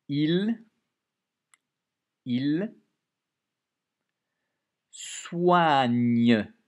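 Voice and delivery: loud, stretched "soigne"; dictation emphasis. Shown as a demonstration. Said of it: "Toujours une lettre muette à la fin".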